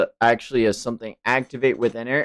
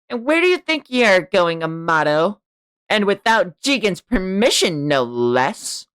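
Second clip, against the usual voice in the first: Delta voice; 9 semitones higher, 4 dB louder than usual. Acted. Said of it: "Delta appears on the scene and says".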